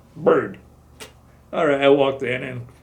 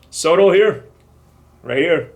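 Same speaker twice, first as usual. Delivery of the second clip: loud, higher call; 5 semitones higher, 10 dB louder than usual. Retold or imitated. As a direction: call his name across the room. MPs call him out.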